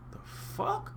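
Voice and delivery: whispered, stunned disbelief; bewildered child. Retold or imitated. Punished kid left puzzled, whispering in disbelief. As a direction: say it under the breath, mock-baffled.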